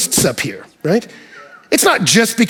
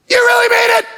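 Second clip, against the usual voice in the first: excited shout; 3 dB louder than usual. Retold or imitated. Retelling his answer to young preacher.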